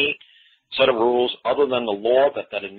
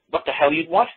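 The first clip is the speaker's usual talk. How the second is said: baffled cashier; 7 semitones higher than usual. Pretend. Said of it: "the lady in the window would be like".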